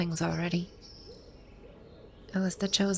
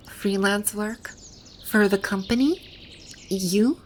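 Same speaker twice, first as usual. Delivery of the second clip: louder, disbelieving questions rising at the end; incredulous; 5 dB louder than usual. Acted. Learning the listener worked for her makers, incredulous. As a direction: question each piece in growing disbelief.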